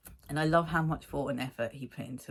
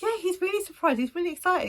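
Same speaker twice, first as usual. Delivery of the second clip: mock surprised; 5 dB louder, faster than usual. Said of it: "I was like".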